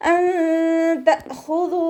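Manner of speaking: slow, high, drawn-out Quranic chant; measured tajweed recitation. Shown as a demonstration. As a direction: recite it in tajweed, stretching each sound. Recites verse between explanations.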